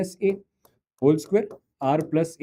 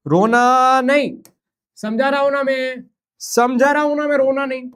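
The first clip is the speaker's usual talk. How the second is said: mock soothing; 7 semitones higher, 5 dB louder than usual. Teacher mock-soothing a crying student, high and coaxing.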